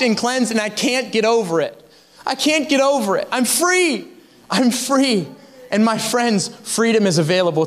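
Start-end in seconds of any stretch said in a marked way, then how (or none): 2.3-5.3 joyful exultation. Real, not acted. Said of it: "exults in forgiveness".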